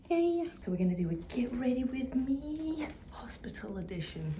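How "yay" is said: higher, louder cheerful yay; excited yay. Real, not acted. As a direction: cheer it up brightly.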